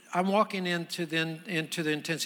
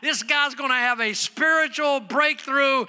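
high, loud, driving sermon delivery; full-force preaching; 9 semitones higher, 8 dB louder than usual. Real, not acted. Preacher telling congregation of Naaman and the prophet, full-force preaching. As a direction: preach it hard, pitched well up.

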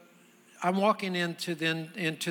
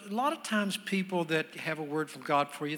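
ordinary talk

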